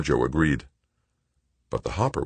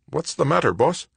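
high-pitched, flustered question; more flustered than fearful; 12 semitones higher than usual. A narrator's reading. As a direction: ask in rattled confusion, pitched up. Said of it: "his voice more flustered than fearful".

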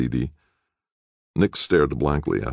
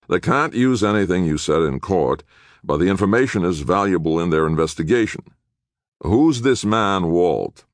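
lightly voiced lawyer, raised pitch; matter-of-fact public defender; 5 semitones higher, 4 dB louder than usual. Public defender advising jailed clients, matter-of-fact. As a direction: explain it firmly as a tired lawyer. Attorney's character voice in narration.

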